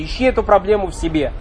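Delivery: usual speaking voice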